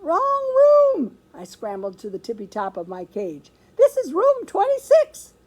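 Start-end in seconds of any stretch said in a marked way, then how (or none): 0.0-1.2 very high, loud squeaked warning; squeaky hamster. A narrator's reading. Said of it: "my voice is small and squeaky". 3.8-5.3 very high, loud squeaked protest; squeaky hamster. A narrator's reading. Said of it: "my voice is small and squeaky".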